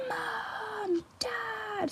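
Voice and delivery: high, hushed mock scream; imitated child's scream. Five-year-old sister screaming for parents downstairs, hushed mock scream. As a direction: softly mimic a little sister calling out, high-pitched. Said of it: "my five-year-old sister screaming".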